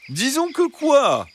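high, slow fox voice, pressing; insisting fox. Acted. Fox pressing the hens to finish, insistent. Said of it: "insista le renard".

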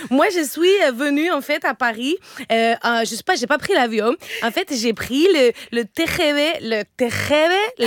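put-on Lola's thick Spanish accent, stumbling on French names. (accent espagnol appuyé, bute sur les noms français)

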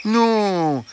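high, gentle fox, slowing at the end; high reassuring fox. A narrator's reading. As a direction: soothe them like a harmless friend.

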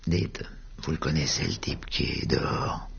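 low, hushed question to the barman. Acted. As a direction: keep it under your breath. Question discrète au patron. (question chuchotée au patron)

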